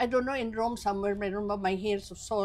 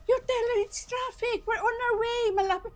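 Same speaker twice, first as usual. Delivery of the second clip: hurried reply; 13 semitones higher than usual. Retold or imitated. Relaying reassurance in traffic.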